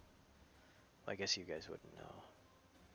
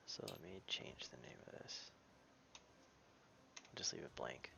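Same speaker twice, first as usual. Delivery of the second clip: muttering to self; 9 semitones lower, 14 dB quieter than usual. Real, not acted. GM muttering while editing.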